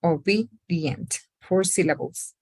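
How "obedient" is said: Obedient slowed, second syllable be leaned on; slow model word, stress on be. Shown as a demonstration. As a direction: stretch out Obedient, hitting the be. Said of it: "se estresa la segunda sílaba".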